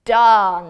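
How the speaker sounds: Darn punched loud and falling; teaching word darn. Shown as a demonstration. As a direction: say Darn loudly as the answer. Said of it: "what was that word".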